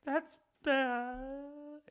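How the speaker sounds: high, soft, long-drawn tearful whine; hammed-up weepy lament; mock crying. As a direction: stretch it into an imitated sob. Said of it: "Crying, dude".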